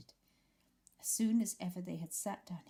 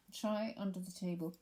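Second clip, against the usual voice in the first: ordinary delivery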